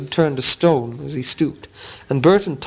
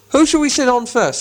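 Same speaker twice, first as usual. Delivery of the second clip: high, louder troll question; the sly voice stirring trouble; 10 semitones higher, 3 dB louder than usual. Acted. Gandalf's fake troll voice to the trolls, high and sly. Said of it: "said the voice".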